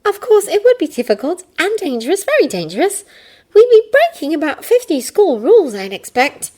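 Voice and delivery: put-on high girl voice, reasoning; Hermione voice. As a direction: lay out the logic.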